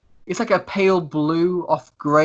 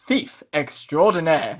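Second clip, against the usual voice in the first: grand, very drawn-out announcement; theatrical boast for the whole room to hear; Lucius, loud self-introduction; much slower than usual. Acted. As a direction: draw out every word like a showman. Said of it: "he says this quite loud".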